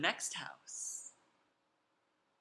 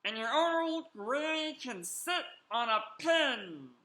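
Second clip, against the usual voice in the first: pig yelling; 5 semitones higher than usual. Acted. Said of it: "The third little pig yelled".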